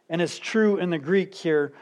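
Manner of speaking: ordinary talk, nothing marked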